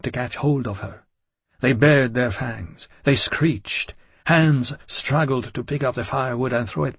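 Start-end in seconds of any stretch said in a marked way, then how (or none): none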